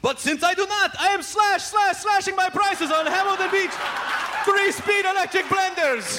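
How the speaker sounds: high-pitched mock madman salesman; hammed-up local TV commercial voice; Crazy Gideon. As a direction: pitch the deals like a deranged appliance dealer. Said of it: "focused on the idea of sanity".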